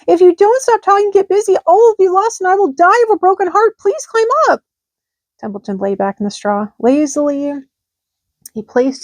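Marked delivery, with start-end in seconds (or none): 0.1-4.6 high, loud desperate plea; high loud despairing pig. A narrator's reading. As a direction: beg in despair. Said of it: "said Wilbur in despair".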